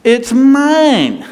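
high and loud, it's mine; a mock petulant claim; childish possessive retort. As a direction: snap back like a kid grabbing a toy. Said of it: "my first impulse".

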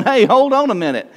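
a hint of an indignant man objecting; Lazarus. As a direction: plead innocence in a high, lightly mocked voice. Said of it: "can't you see him going".

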